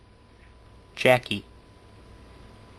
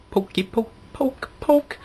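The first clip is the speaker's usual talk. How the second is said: climbing higher and higher, sing-song; playful poking; 10 semitones higher than usual. Retold or imitated. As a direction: poke the body in a childish voice. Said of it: "I was all like".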